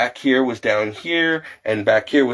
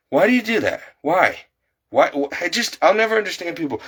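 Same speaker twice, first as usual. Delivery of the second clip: higher, incredulous, fed up; exasperated why; 6 semitones higher than usual. Real, not acted. Ranting vlogger asking why people litter, incredulous. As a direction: throw up your hands in disbelief.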